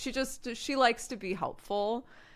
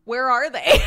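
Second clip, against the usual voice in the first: louder call, higher; 5 semitones higher, 10 dB louder than usual. Retold or imitated. Mom and daughter calling for dad and brother, louder. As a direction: call out for the missing family. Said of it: "we're both like".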